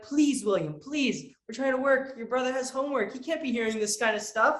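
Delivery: mock parental pleading; pleading parents. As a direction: beg a kid to stop, mock-weary. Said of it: "my parents were like".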